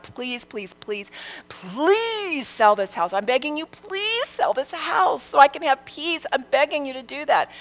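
breathless whispered please, please, rising into a high begging plea; pleading prayer. Retold or imitated. Mother begging God to sell the house, whispered desperate plea. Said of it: "pleading with God".

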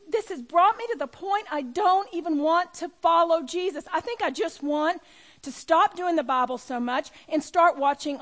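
high, loud, worn-out cry to her husband; tearful pleading outburst. Retold or imitated. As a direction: plead through tears that you're done. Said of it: "I was sobbing".

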